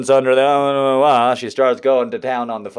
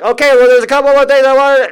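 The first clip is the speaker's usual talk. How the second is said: high, loud, rapid-fire sales patter; auctioneer; 14 semitones higher, 11 dB louder, faster than usual. Retold or imitated. Saleswoman pushing extras on the customer, auctioneer patter. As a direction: rattle it off like an auctioneer. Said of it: "now she's like an auctioneer".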